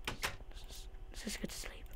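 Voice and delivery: hushed scared whisper; nervous whisper. Real, not acted. Scared player whispering about going to sleep. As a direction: whisper it, eager to hide. Freaked out, heading to bed.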